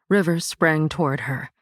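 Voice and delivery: plain reading voice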